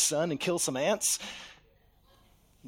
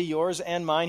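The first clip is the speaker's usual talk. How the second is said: ordinary talk, nothing marked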